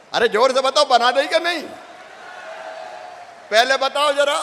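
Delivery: rousing call. Real, not acted. Leader prodding a rally crowd about the roads, rousing call.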